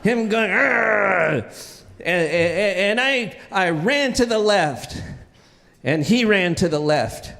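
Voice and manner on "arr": long imitated growl. Coach chasing the kid down the hall, long growl. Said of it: "he's growling at me".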